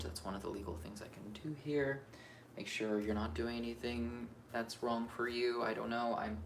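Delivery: usual speaking voice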